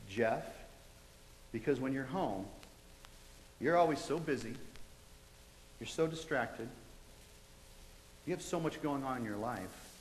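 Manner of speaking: retelling the voice, low and soft; soft but clear. Retold or imitated. The voice explains why it waited, soft and clear. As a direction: speak gently and clearly, unhurried with pauses. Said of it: "real soft but real clear".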